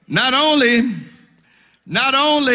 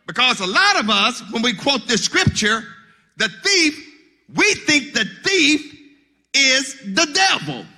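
usual speaking voice

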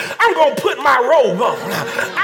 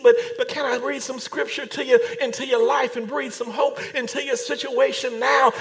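ordinary talk, nothing marked

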